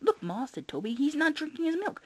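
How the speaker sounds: put-on high, tattling kid; child voice. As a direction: tell on it like a little kid. Kid pointing out.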